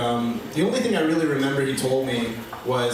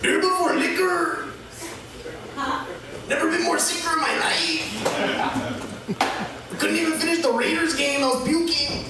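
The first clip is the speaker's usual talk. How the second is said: high, put-on East LA Mexican dad voice; exaggerated East LA Mexican accent; dad's East LA Mexican accent; 13 semitones higher than usual. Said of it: "We've really been working on the accent".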